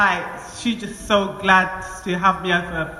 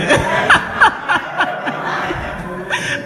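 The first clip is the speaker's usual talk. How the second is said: a long, airy laugh; high breathy laugh; 9 semitones higher than usual. Real, not acted. Joking that the Spirit possessed her, high breathy laugh. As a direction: laugh at her own boldness.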